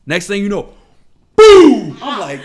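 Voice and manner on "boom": loud firework boom imitation; sudden explosive boom sound; imitated boom. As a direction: shout the blast like a firework going off.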